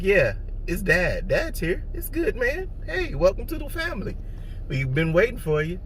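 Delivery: a dad soothing his crying newborn, high and soft; tender baby talk. Retold or imitated. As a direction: coo to the newborn, reassuring him. Talking to his newborn son.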